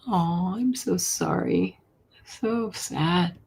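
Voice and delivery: normal talking voice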